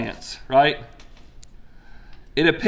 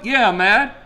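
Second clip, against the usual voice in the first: petulant Jonah; 6 semitones higher than usual. Retold or imitated. Jonah's angry reply to God.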